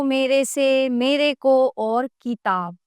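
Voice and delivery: मेरे को और किताब said slowly, word by word; slow pointing out. Shown as a demonstration. Teacher slowly listing the wrong words. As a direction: spell out the errors slowly. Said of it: "शब्द अशुद्ध है".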